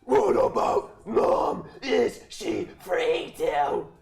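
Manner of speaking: promposal. Acted. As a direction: bellow it like a metal prom invite. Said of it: "Death Metal Promposal voice for one page".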